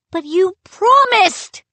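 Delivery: high, loud protest on promised; crying out. A narrator's reading. Younger brother protests to the pirate, loud high cry. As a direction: wail it out in outrage. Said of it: "Sean cried".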